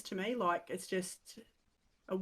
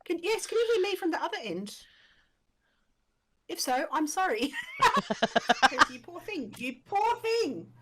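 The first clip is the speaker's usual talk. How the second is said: Ocker accent; 8 semitones higher, 5 dB louder than usual. Real, not acted. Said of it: "Me and my Ocker accent".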